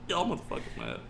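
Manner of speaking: ordinary talk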